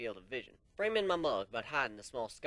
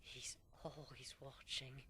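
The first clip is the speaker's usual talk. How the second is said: much softer, slow, hollow dread; terrified hush; 9 dB quieter, slower than usual. Acted. Frightened unicorn warning about the watcher. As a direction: breathe it out like he's listening. Terrified Wisp hints at stalker.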